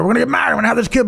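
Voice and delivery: high, eager rush, re-enacted; retold excitement. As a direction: gush as if replaying your old enthusiasm. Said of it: "I'm, like, all excited".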